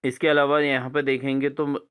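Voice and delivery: ordinary talk, nothing marked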